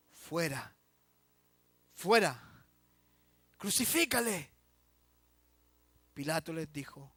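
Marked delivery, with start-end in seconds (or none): 0.1-4.5 mock crowd shout, louder on the second fuera; grito de la multitud. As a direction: cry out like the mob. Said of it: "Pero ellos gritaron". (grito de la multitud, a medias)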